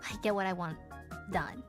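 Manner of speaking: ordinary talk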